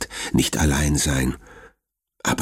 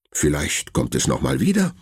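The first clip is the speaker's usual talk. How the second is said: plain reading voice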